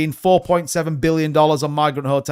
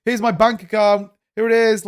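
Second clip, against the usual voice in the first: higher, smug boasting voice mocking a YouTuber; mock show-off; 6 semitones higher than usual. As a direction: show off your bank account in a sing-song higher voice. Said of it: "It's like going".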